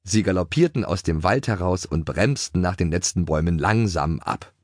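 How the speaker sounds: plain reading voice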